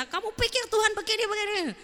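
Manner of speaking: high-pitched acted exasperation; angry rebuke. Moses tears into sulking Joshua, acted angry rebuke. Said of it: "Dia marah sekali".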